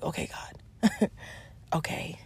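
hushed, slow, nearly whispered aside; resigned quiet reply. Retold or imitated. Retelling her answer to God about a new problem, quietly resigned. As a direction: give in softly with a small laugh.

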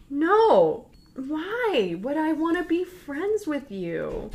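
very high, acted indignant disbelief; loud no, then high drawn-out scorn; incredulous refusal. Zola rejects Jess's friendship.

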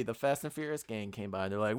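normal talking voice

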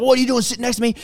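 high, hammed-up territorial surfer; mock aggro local. Imitating a rude surfer who thinks he's local, high and mocking. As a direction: sneer it like a local who owns the break. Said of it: "swearing at people on the waves".

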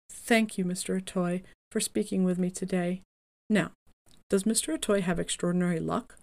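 normal talking voice

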